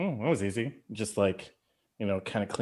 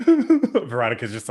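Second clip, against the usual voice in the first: ordinary delivery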